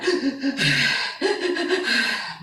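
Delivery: long mock crying gasps; gasping sobs, imitating the son; imitated sobbing. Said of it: "he's just crying".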